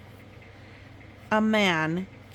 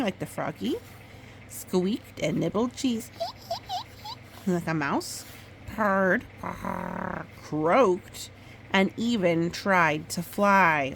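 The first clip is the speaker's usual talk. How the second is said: gentle storybook read-aloud; reading to a child. Acted. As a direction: tell it like a bedtime story. Mother reads picture book aloud.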